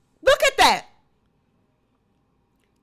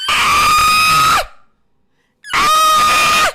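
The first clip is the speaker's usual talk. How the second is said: very high loud straining scream; strained toilet yell; 9 dB louder than usual. Retold or imitated.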